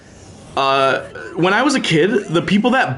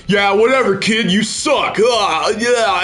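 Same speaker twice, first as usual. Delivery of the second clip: higher, slow, mock-dismissive shout; fake tough guy; 7 semitones higher, slower than usual. Streamer imitating his fake-tough brush-off to a player. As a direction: pretend you don't care, hamming it up. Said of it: "I'll pretend to be like".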